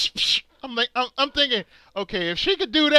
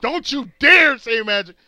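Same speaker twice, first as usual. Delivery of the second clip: ordinary delivery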